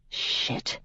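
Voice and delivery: breathless whispered swear; soft whispered oath; whispered curse. A narrator's reading. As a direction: hiss it under your breath in the church.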